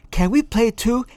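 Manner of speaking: high-pitched, eager little voice; high, hopeful pleading; eager interjection. A narrator's reading. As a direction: cut in eagerly, pitched right up. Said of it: "interjected Vicky Bow".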